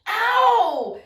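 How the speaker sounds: high, loud acted yelp; mock pain yelp. Dad whose chest hair is pulled, loud imitated yelp. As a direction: cry out as if plucked. Dad's pain cry in story.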